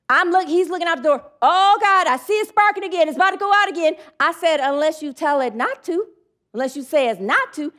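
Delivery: normal talking voice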